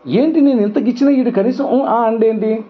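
high, mock-puzzled voice of God pinching; God asking why there's no reaction, high and quizzical. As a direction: play God wondering why he doesn't react, pitched high. Voicing God testing him.

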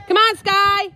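high, loud coaxing call; calling horses. Real, not acted. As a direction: call them over across the field.

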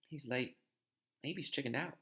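soft, furtive murmur; hushed. A narrator's reading. Boys await Malfoy in the trophy room, hushed. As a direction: keep it down while lying in wait. Waiting in hiding at night.

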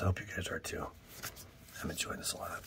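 quiet unvoiced whisper; hushed happy aside. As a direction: confide it to viewers in a whisper. Whispered aside while opening.

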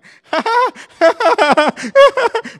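high loud laugh that wobbles into a cry; laughing-crying noise. Shown as a demonstration. Showing how the toddler laughed and cried at once, high mixed laugh-cry. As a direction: show a child caught between laughing and crying. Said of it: "like a laughing, crying, like".

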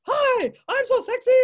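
very high, loud, hammed-up suitor; preening male tarantula. Male tarantula courting the female, hammed-up high squeal. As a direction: show off in a high excited squeal. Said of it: "And he goes".

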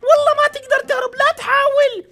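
high, loud taunting policewoman voice; villain policewoman. Acted. Player voicing the policewoman taunting escaping prisoners, high and loud. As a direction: sneer it down from way up high.

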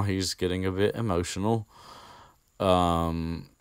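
usual speaking voice

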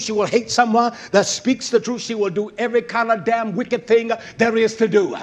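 driving, relentless sermon cadence; fiery preaching. Real, not acted. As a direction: pile up the accusations in rhythmic bursts. Preaches at full force.

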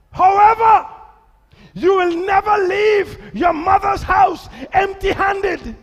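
loud, high, slow preaching. Acted. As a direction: shout it out to the church. Preaching at full force.

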